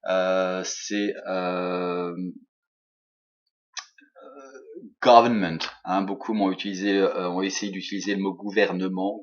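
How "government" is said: government with English sounds, stressed on the first syllable; English-style government, short final syllable; English pronunciation. Shown as a demonstration. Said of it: "en anglais".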